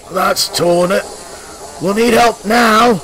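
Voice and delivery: high, loud dismayed voice; slow it down in dismay; exasperated crewman. A narrator's reading. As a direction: crewman after the engine bursts. Crew after the boiler bursts.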